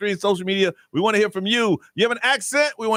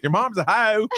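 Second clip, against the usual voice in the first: mock Southern drawl, higher-pitched; dragged Southern insult; 4 semitones higher than usual. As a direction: tease with a drawled Southern HOE. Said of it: "you gotta drag it up".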